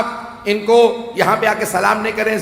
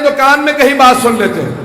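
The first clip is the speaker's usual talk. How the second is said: high, loud preaching climax; rising shout; 6 semitones higher, 6 dB louder than usual. Real, not acted. Preacher contrasting whisper with Ghadir proclamation, shouting. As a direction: build to a cry over the crowd.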